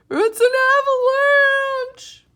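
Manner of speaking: very high, loud, slow panicked cry. Acted. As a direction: scream a warning in terror. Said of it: "cried Toad".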